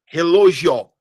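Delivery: "relógio" with the stress pushed later, re-lo-gi-Ó; stress moved off its syllable. Shown as a demonstration. Teacher shows a moved accent to students, stress shifted later. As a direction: punch the end of "relógio" instead of LÓ. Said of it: "se eu mudar o lugar do acento".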